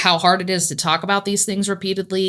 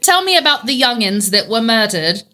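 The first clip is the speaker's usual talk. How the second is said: mock English accent; 4 semitones higher, 4 dB louder than usual. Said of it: "I'm trying to keep it up".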